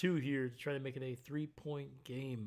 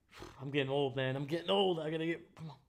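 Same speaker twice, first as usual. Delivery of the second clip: ordinary talk, nothing marked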